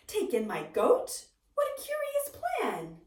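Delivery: leaps high on What in disbelief; baffled old lady, rising shock; astonished old lady. Acted.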